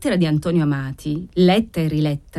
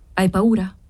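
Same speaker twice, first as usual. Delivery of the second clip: ordinary delivery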